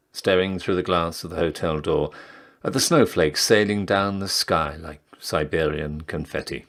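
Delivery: ordinary delivery, nothing marked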